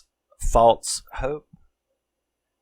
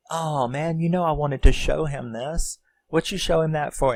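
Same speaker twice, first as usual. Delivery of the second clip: higher-pitched put-out complaint; Kenny; 7 semitones higher than usual. Retold or imitated. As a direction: play a guy whining over a spoiled surprise. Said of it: "when Kenny comes down, he's like".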